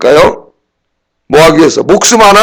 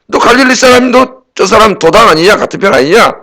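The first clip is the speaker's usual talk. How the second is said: 다그치는 말투; 6 semitones higher than usual. Retold or imitated. Bystanders confront Peter.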